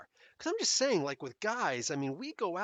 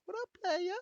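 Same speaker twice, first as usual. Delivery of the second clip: voice cracking up an octave. Real, not acted. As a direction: voice cracks high on playa. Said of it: "My voice just cracked".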